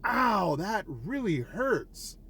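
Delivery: sudden high, loud yelp of pain, then slow. Retold or imitated. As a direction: yelp like something just hit your leg. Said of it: "And I'm like".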